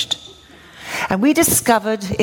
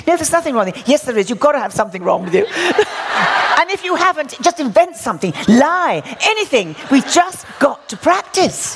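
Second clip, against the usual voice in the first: laughing mock insistence; 6 semitones higher than usual. Acting out pushy practice dialogue.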